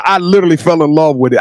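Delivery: ordinary talk, nothing marked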